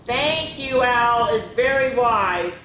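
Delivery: bubbly story-character excitement; excited thanks. A narrator's reading. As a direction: gush with excited gratitude. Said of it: "she excitedly told her friends".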